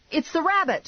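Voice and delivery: high-pitched urgent cry; yelling. A narrator's reading. Driver spotting the rabbit ahead, yelling. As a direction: yell the alarm, pitch way up. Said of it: "I yelled".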